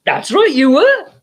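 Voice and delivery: a hammed-up coy reply; playful teasing. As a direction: tease him back as if it's a private joke. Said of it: "she was like".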